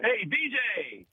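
high, drawn-out mock holler; stretched-out retold yell; imitated shout. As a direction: call out like a heckler across the room. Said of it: "some guy shouts".

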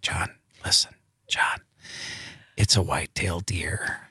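urgent hushed whisper. Retold or imitated. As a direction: whisper it to a nervous hunter. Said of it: "I'm like".

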